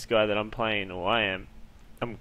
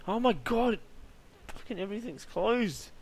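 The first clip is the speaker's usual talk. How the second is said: much higher, frantic outburst of real fright; high panicked yelling; panicked cry; 11 semitones higher than usual. Real, not acted. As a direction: cry out in panic as every door stays shut. Panicking in dark horror scene.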